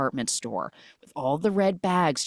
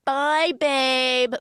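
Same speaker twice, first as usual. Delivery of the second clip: mock girly; 9 semitones higher, 4 dB louder than usual. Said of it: "they're like".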